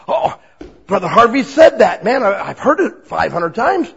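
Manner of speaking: loud, fast exasperated complaint; a believer groaning at their own memory; frustrated believer. Retold or imitated. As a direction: throw up your hands in exasperation.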